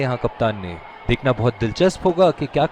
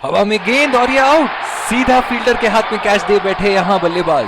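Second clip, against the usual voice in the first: excited cricket commentary; 5 semitones higher than usual. Acted. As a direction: shout out the wicket as it happens. Live cricket wicket call.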